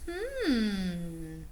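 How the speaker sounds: ordinary delivery, nothing marked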